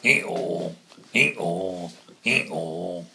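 very loud braying call; donkey call. Acted. Donkey brays.

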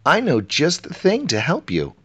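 eager treasure keeper. A narrator's reading. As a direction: chirp it up as a keen palace servant.

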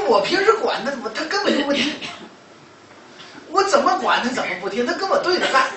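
high, loud voice of a fed-up mother; acting out an aggrieved parent's grumble; exasperated complaint. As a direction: protest helplessly, pitched well up and forceful. Retold mother complains about her child.

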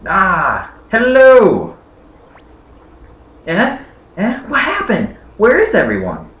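put-on young hero voice, pitched well up, calling out; high character voice. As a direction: call out brightly to people who have vanished. Voicing a game character.

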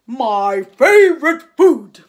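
loud, slow monster growl; a big hungry beast; Gruffalo voice. A narrator's reading. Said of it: "the Gruffalo said".

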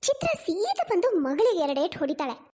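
ordinary delivery

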